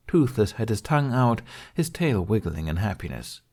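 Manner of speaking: ordinary delivery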